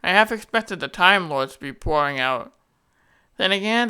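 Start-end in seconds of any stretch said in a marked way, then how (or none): none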